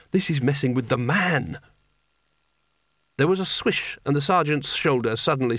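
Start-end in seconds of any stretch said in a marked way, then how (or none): none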